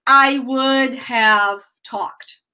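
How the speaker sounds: slow, every word given its weight; slow full form. Shown as a demonstration. Teacher modelling the full form for the class. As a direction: sound out would have fully. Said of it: "It's perfect, right?".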